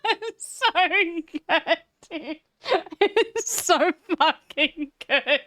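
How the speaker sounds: high, breathless amusement; laughing. Real, not acted. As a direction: gush through real laughter. Streamer still cracking up.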